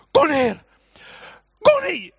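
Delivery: high, loud panicked yell; uncontrolled shout. Retold or imitated. Father to daughter on the roof, uncontrolled re-enacted shout. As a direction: lose control and scream the order. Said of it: "Jag kunde inte behärska mig".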